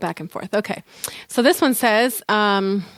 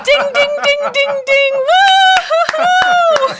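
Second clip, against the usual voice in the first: high, loud, laughing victory chime; squealing ding-ding celebration; excited cheer; 7 dB louder, much slower than usual. Real, not acted. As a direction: ring it out like a game-show winner. Celebrating the review.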